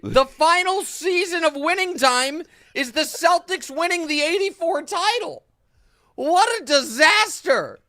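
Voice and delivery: loud, high, gleeful ranting; excited rant. Real, not acted. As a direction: rant it with delighted outrage. Gleeful rant about cancelled show.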